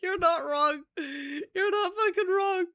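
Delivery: high, loud squeal of amused agreement; high-pitched, cracking up; laughing. Real, not acted. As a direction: agree helplessly through laughter. Cracking up at chat's remark.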